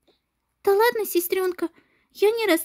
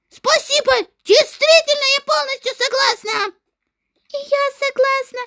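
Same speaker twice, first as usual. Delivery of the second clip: put-on higher, louder girlish outrage; indignant schoolgirls; 6 semitones higher, 3 dB louder than usual. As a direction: pile on the rude boy together. Girls gang up on bully.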